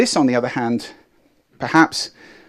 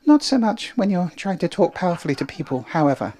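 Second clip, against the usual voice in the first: falsetto; 7 semitones higher than usual. Shown as a demonstration. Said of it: "uses falsetto a great deal".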